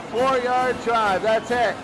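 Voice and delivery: ordinary talk, nothing marked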